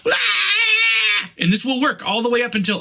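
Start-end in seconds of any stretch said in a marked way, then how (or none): 0.0-1.3 high mock baby scream; imitation infant wail. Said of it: "because they're screaming".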